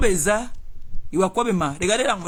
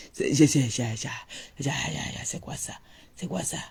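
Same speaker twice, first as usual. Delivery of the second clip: fading mock stammer; 4 semitones lower, 11 dB quieter than usual. Mock stammer trailing to whisper.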